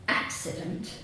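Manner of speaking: ordinary delivery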